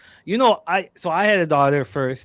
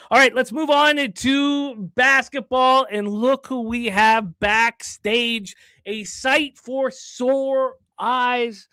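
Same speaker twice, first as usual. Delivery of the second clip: higher, drawn-out announcer delivery; slow, high-pitched hype for a returning guest; show host introduction; 9 semitones higher, much slower than usual. Acted. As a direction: stretch each word to build anticipation.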